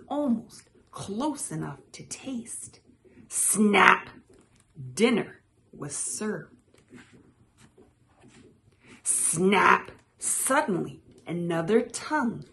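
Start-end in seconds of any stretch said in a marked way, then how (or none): 3.3-4.1 loud, punchy snap; exclaimed sound word. A narrator's reading. Storyteller voicing the tongue catching the fly, sudden and loud. 9.0-9.9 loud, sudden snap; exclaimed sound word. A narrator's reading. Storyteller voicing a second tongue striking, sudden and loud.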